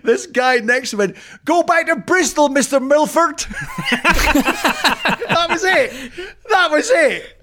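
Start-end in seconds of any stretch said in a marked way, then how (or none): 1.4-3.4 high-pitched mock shout of abuse; strained, high heckle, imitating a fan; retold terrace shout. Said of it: "the worst form of abuse was shouted". 3.5-7.4 high, helpless laughter through the words; real laughing, voice pitched up. Real, not acted. Laughing at his own story.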